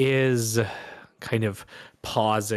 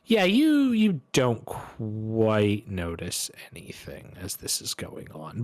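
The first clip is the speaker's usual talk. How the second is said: normal talking voice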